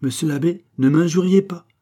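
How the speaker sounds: high, forceful caution; warning. A narrator's reading. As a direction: warn him firmly, pitch climbing. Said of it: "avec un air d'avertissement".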